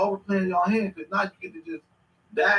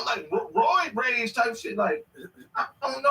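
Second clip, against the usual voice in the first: normal talking voice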